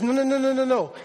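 rapid run of no's, pitched high; emphatic refusal. Acted. Paul correcting the Greeks' idea of the soul, emphatic refusal. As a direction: cut them off with a high string of no's.

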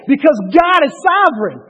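loud, rising sermon shout; full-force preaching. Acted. Pastor challenging his congregation about God's sovereignty, loud fiery preaching. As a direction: preach it hard, climbing to a shout.

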